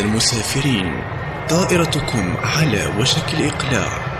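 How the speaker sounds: intercom. Acted. Airport boarding announcement.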